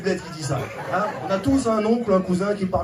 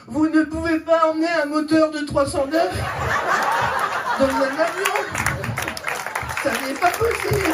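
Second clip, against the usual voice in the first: prim airport agent; 7 semitones higher than usual. Retold or imitated. Airport woman quoted again.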